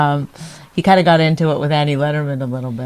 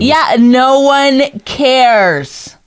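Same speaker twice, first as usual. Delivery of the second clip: very slow, loud yell, higher up; 7 semitones higher, 3 dB louder, much slower than usual. Real, not acted. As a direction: drag it out and yell to cut him off. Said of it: "There's no reason to yell".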